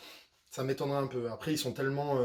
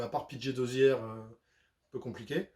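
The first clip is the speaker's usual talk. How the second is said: ordinary delivery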